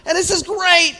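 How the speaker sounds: high, loud, carried-away storytelling; excited retelling. Real, not acted. Preacher caught up in child's joy.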